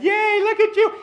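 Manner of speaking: high, mock-cheery praise; mock cheer. Boss publicly praising an introvert, mock cheer. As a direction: cheer too much, as if for show. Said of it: "calling them out and saying".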